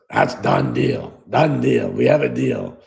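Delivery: low, slow, rasped-out agreement; gravelly GM impression. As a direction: close the deal in his gravelly growl. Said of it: "You know how he had the gravelly voice?".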